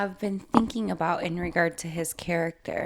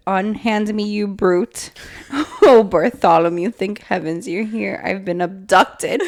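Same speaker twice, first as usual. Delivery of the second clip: loud, hammed-up protest in bursts; mock yelling; 8 dB louder than usual. Gargoyle complains to Bartholomew of being abducted, mock yelling. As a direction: yell it theatrically, as an outraged captive. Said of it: "Stop yelling".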